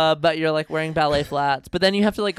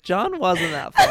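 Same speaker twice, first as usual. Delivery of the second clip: laughing; 5 semitones higher, 6 dB louder, slower than usual. Retold or imitated. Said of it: "Boomba is like".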